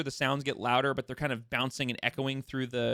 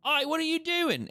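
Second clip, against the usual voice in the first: high, sharp halfling challenge; gruff little halfling shouting oi; halfling yelling. Acted. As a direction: call out to stop the intruder. Halfling guard yells at squirrel.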